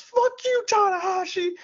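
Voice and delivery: very high-pitched mock yell; imitated yell. Imitating Gallows yelling at his opponent, high mock shout. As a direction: holler it high across the ring. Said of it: "he just yells".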